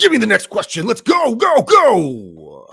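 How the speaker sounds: high, loud hyped announcer shout; monster truck announcer. Retold or imitated. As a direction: hype it like a rally announcer. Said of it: "me in that voice".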